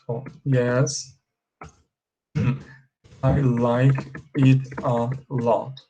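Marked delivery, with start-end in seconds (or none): 3.2-5.8 slow model sentence. Shown as a demonstration. Teacher repeating the right phrase for students, slow and careful. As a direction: spell it out slowly so students can copy. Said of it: "You should say".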